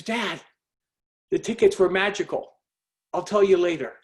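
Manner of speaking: high-pitched imitation of a thrilled caller; excited daughter. Daughter phoning dad from the concert, excited.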